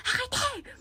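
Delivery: high pleading shout; retold cry of stop. Schoolgirl yelling at classmates to stop. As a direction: yell it out to stop them. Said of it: "et j'ai crié".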